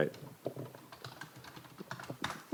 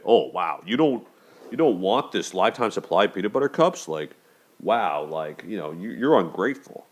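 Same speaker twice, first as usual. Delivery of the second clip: lightly mocking, pitched up, offended disbelief; mock indignant; 3 semitones higher than usual. Gift giver scoffing at the allergic friend, mild mock indignation. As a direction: play the giver scoffing at ungratefulness. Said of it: "Do I have a right to say".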